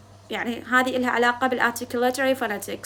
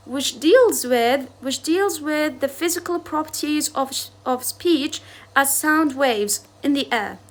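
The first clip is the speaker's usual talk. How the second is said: slow dictation; much slower than usual. Shown as a demonstration. Dictating English definition to class.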